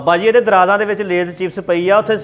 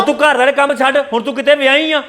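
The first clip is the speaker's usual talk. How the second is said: raised, high-pitched scolding of his wife; scolding loudly; 6 semitones higher than usual. Acted. Husband scolding his wife in front of her father, loud and high. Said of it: "ਹੌਲੀ, ਹੌਲ� ਬੋਲ ਮੇਰਾ ਪੁੱਤ".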